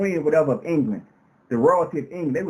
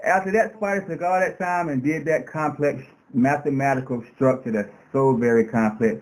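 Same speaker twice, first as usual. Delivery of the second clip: normal talking voice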